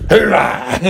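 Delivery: playful drawn-up hoorah; mock cheer. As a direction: echo a cheer for fun. Echoes co-host's cheer, then laughs.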